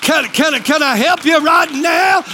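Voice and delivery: usual speaking voice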